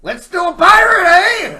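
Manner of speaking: excited. Real, not acted. Proposing pirate, loud and high.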